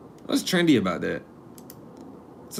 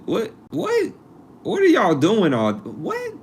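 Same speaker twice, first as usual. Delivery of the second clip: baffled; 11 semitones higher than usual. Real, not acted. Vlogger to young people over toilet slang, baffled. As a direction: pitch leaping up in bewildered questions.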